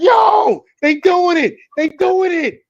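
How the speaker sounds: loud, high-pitched mock shout; hammed-up hollering, way up high; excited shouting. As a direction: yell it out in amazed recognition. Acting out shocked reaction to show.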